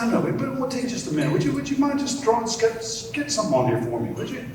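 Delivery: high, fast wheedling plea; a fan nagging Picasso, pitched high and quick; pushy fan. Acted. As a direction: rush the ask, way up high. Admirer keeps pressing Picasso.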